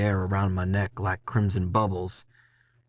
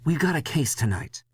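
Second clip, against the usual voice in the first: higher boss voice, matter-of-fact; Leslie, boss; 8 semitones higher than usual. Acted. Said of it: "he says".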